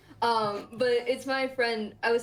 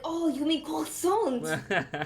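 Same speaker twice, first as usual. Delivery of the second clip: put-on drawn-out, higher French accent on the word; mock French correction; 4 semitones higher, much slower than usual. French person correcting a foreigner's pastry word, mock French accent. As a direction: imitate a French person correcting you, slowly. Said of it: "say it to a French person".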